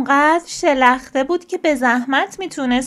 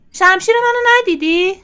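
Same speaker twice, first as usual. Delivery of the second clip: put-on higher, louder child's question to a parent; asking question; 6 semitones higher, 6 dB louder than usual. A narrator's reading. Franklin asks his mum about his missing sword, a raised, louder question. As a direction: call out the question, raised and loud. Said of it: "فرانکلین از مامانش پرسید".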